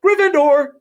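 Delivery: high, loud cry at last; hat shouting. Acted. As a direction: shout the house with relief. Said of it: "When it finally shouted".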